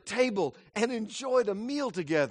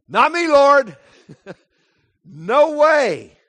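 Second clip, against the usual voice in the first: high, loud brash denial; loud arrogant protest; 7 semitones higher, 12 dB louder, much slower than usual. Acted. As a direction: boast it at the top of your voice. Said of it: "Peter's protest was undoubtedly just that loud and arrogant".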